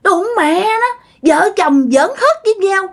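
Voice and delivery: high, bitter spiteful voice; jealous ghost. Retold or imitated. Ghost of the father envying the happy couple, spiteful and high. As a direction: spit out the envy. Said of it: "Nói kiểu vậy các bạn, ganh tị".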